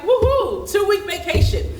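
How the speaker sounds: high, loud, gleeful woohoo; excited cheer. Retold or imitated. Recalling her joy at sick leave, a loud high cheer. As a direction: cheer about the time off. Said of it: "I was just like".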